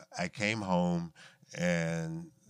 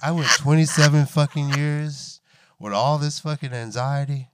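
loud, higher mock outrage; exasperated outburst; 8 semitones higher, 16 dB louder than usual. Adult child after parents' calm reply, exasperated mock outburst. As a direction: cry out in laughing disbelief.

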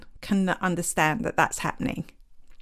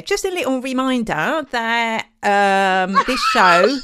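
raised, playful Essex twang; exaggerated Essex accent; 3 semitones higher, 5 dB louder, slower than usual. Shown as a demonstration. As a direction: lay on the Essex and laugh into it. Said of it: "I sounded very Essex there".

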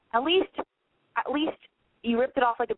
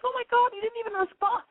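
squealed, high and breathless; high girly voice; 12 semitones higher than usual. Pretend. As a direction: squeal it like a friend venting. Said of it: "You can call me next week and be like".

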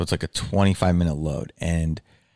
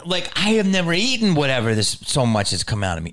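ordinary talk

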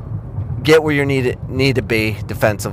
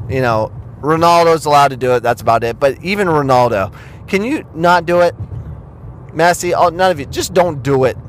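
ordinary talk

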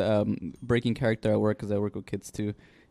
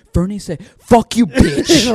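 put-on higher, louder earnest kid tattletale; serious little girl; 11 semitones higher, 11 dB louder, slower than usual. Little girl tattling on Fernie, dead-serious kid voice. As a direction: imitate her, drawing out the swear. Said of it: "The most serious face. She's like".

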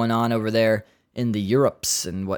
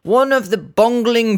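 a hint of a mock British inspector, pitched higher; British accent; 9 semitones higher, 4 dB louder than usual. Host reading a police tweet in mock British accent. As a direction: read the tweet in a put-on British voice. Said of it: "that word is so British".

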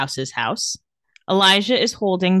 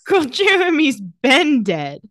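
put-on slow, high, sassy drawl on girl; sassy retort; 5 semitones higher, 3 dB louder, slower than usual. Host telling the character the obvious, slow sassy drawl. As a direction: stretch it out with attitude.

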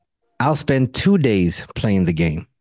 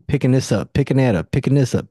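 ordinary delivery

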